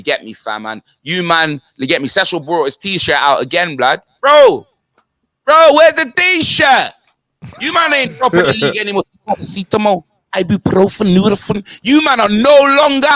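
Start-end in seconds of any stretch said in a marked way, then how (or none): 4.2-9.0 taunting call. Acted. Calling out Cecil about his T-shirt, loud taunt. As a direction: drag it out to mock him.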